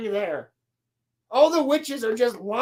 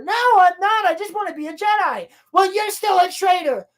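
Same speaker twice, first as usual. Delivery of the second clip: mock bickering, higher; 6 semitones higher, 3 dB louder than usual. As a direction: snap back and forth. Acted quarrel continues.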